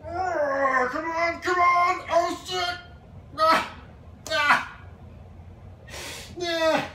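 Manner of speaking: high strained grunts; straining effort. Real, not acted. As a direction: push through a hard pull-up. Straining at pull-up.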